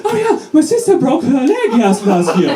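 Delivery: high-pitched, overeager small talk; mock gushing. Acquaintance hearing the speaker is German, gushing and high-pitched. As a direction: imitate someone chirping excitedly far above your voice. Mocks the same gushing reply.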